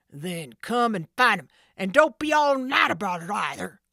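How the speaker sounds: loud gruff order; bossy thug. Acted. Sid ordering Jake to search, impatient. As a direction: command him impatiently.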